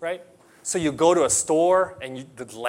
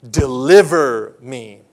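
slow earnest plea; 4 semitones lower, 8 dB louder, much slower than usual. Acted. Praying line of psalm.

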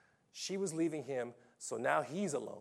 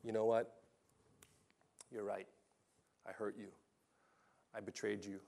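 remorseful husband; 3 semitones lower, 7 dB quieter than usual. Retold or imitated. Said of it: "Second husband says".

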